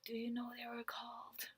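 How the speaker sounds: hushed whisper, leaning in close; whispered aside. Retold or imitated. Whispering a warning to the stranger beside her. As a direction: whisper it so the recruiters can't hear. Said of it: "Of course, I still said loud enough for them to hear".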